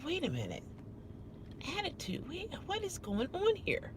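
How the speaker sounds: very soft baffled questioning; retold hushed confusion, as if thinking aloud; puzzled. Guest wondering at the manager's accusation, puzzled. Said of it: "I was really puzzled".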